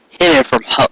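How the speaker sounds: ordinary delivery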